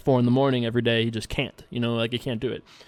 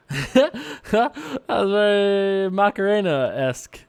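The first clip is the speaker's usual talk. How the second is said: laughing; 6 semitones higher, much slower than usual. Real, not acted. Laughing at own clumsy move.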